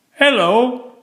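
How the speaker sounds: high called-out hello; shout. Acted. Townsfolk greeting in the street, a high mock shout. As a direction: call across the street. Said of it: "they'd shout".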